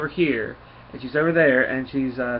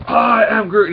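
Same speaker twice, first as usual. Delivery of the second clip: a lightly voiced Groot, higher and louder; 8 semitones higher, 9 dB louder, slower than usual. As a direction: chirp it out like a small tree creature. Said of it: "he goes".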